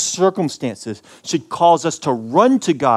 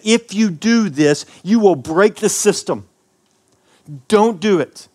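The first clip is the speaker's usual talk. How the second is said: raised, slow, solemn voice of God warning; God's warning; 6 semitones higher, 4 dB louder, slower than usual. Acted. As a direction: speak as God cautioning Adam, high and loud. God voiced warning Adam in Eden.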